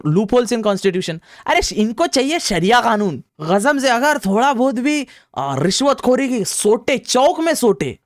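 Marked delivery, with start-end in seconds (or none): 1.5-8.0 high-pitched angry demand; indignant rant, high and forceful; heated outrage. Real, not acted. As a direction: demand punishment in a worked-up, raised pitch. Demanding harsh punishment for bribery.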